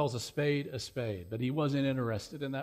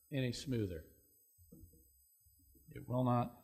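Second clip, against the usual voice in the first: ordinary talk, nothing marked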